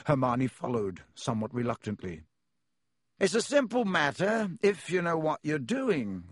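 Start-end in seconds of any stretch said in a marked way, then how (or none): 3.2-6.2 high, airy know-it-all man; raised-pitch pompous expert; loud, self-important. A narrator's reading. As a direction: show off breezily for all to hear. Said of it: "Lockhart was saying loudly".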